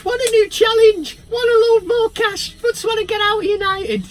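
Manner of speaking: squeaky high mocking footballer voice; high whiny mock footballer; mocking Pogba. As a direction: mimic him whining for a move, way up high. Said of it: "that's what he said in that tone of voice".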